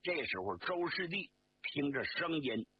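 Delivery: plain reading voice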